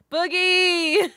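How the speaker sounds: high, loud, drawn-out shout; delighted call-out. Real, not acted. Reader spotting a friend's birthday message, calling out excitedly. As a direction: holler the name with joy.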